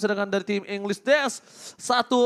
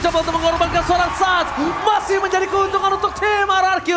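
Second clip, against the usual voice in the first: loud, high hype play-by-play; fighting game announcer; 12 semitones higher, 6 dB louder than usual. Acted. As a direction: call the teamfight at full hype. Hype teamfight call.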